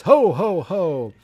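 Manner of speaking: booming mock Santa greeting. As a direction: belt it out like Santa Claus. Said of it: "Maude comes out dressed as Santa Claus".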